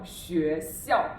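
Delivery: ordinary delivery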